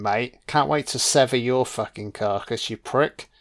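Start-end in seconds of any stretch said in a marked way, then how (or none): none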